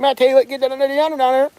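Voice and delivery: very high, garbled Alabama twang; Alabama accent. Acted. Alabama man answering the Englishman, high unintelligible Alabama twang. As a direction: slur it into a high-pitched Alabama country holler. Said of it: "The guy from Alabama would be like".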